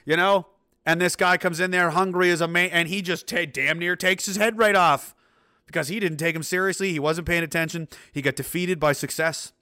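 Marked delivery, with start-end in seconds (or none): none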